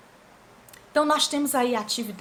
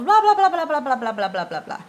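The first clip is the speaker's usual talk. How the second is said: a lightly voiced dog rattling off blah-blah; chattering dog again; 4 dB louder than usual. The chatty dog goes on talking, lightly voiced babble. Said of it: "vem de novo".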